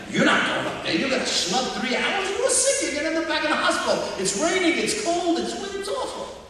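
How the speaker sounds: high-pitched fussing wife, rising as she goes; wife scolding husband not to travel, fast and high; worried wife protesting. Retold or imitated. Wife forbids sick husband to travel.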